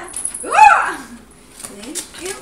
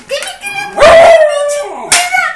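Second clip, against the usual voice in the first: scolding shout; 2 semitones higher, 12 dB louder than usual. Real, not acted. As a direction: yell a challenge at the dog. Scolding a dog that bites. (regaño a gritos)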